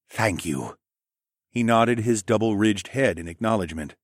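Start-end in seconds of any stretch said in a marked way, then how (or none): 0.1-0.8 lower, lightly voiced formal thanks; grateful raptor man. Raptor man accepting sympathy, low and formal.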